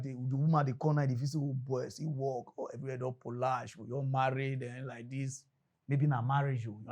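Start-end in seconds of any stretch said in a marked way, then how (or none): 1.4-5.4 dreamy wife. Retold or imitated.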